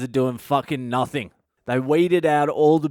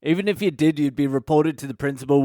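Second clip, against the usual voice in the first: ordinary delivery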